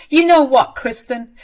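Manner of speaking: angry retort. Acted. Wife finally confronts Kristen.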